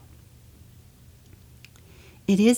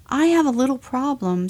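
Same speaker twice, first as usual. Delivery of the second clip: higher, louder bear; insistent bear; 7 semitones higher, 5 dB louder than usual. Acted. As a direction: push to be heard. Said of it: "said the bear".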